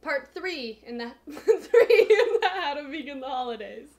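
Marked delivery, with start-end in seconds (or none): 1.3-3.9 laughing through the words, louder; giggling while announcing. Real, not acted. Laughs while announcing the next part.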